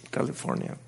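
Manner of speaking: whispered aside. Retold or imitated. Exhausted recollection.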